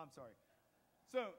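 ordinary delivery

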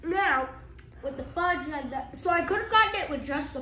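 talking between bites; chewing while talking. Real, not acted. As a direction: mouth partly full of candy. Seen 0: Boy bites the candy stick beforehand, then talks with moving jaw.

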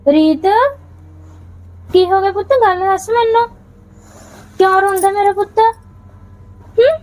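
soothing mother. Acted. Mother comforting her crying child, high and soothing. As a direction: coax the little one gently out of tears.

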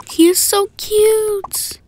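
high, loud, drawn-out swoon; gushing crush. Acted. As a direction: gush over a crush, stretching every word. Girl gushes over a boy.

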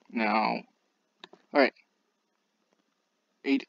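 normal talking voice

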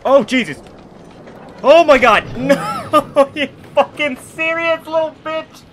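very high, loud panicked yelp; startled outburst. Real, not acted. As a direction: cry out in shock at the game. Startled by the game, cries out.

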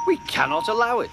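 high, fast outraged protest; indignant protest. A narrator's reading. Henry protesting to the other engines, indignant. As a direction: burst out in affronted refusal.

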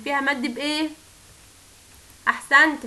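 ordinary talk

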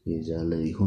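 plain reading voice

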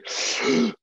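big sharp breath in and out; imitated gasp. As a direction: act out a child's shuddering release of breath. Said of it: "And then he went".

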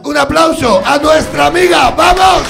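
loud, high call for applause; rousing host shout. Real, not acted. Host calling for applause for the next guest, rousing shout. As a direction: whip up the crowd at full volume.

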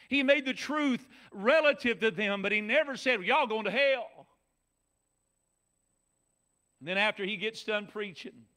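high, loud, rhythmic sermon voice; rising revival preaching; fervent preaching. Real, not acted. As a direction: drive the scripture home to the congregation. Preaching at full force.

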